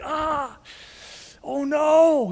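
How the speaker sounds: mock wail, not full out; imitated bawling. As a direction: act out a restrained weeping cry. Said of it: "He starts bawling like".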